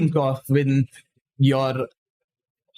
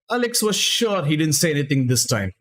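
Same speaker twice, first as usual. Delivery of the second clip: plain reading voice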